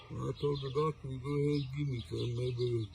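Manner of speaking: usual speaking voice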